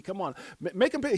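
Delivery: high, urgent donkey plea; mock-insistent donkey; donkey egging on. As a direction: jump up high and loud, goading as the donkey. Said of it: "And donkey".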